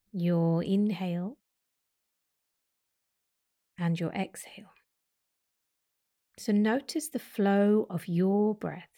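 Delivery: guided meditation. Acted. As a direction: lead their attention to the breath calmly.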